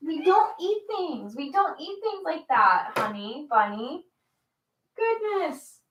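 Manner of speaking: high gentle scold to the cat; scolding a chewing cat; high pet scolding. Real, not acted.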